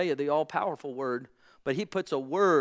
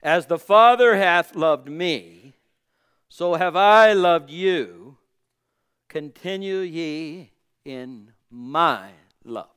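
louder, slow, stressed proclamation of the verse; emphatic slow declaration; louder second reading; 6 dB louder, slower than usual. Shown as a demonstration. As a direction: say it again with more force so it lands. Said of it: "We'll try it one more time".

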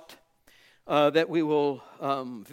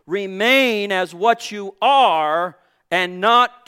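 louder and slow, each word stressed; emphatic repeat; 3 semitones higher, 7 dB louder, slower than usual. Shown as a demonstration. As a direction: drive the phrase home slowly. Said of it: "Did you hear that?".

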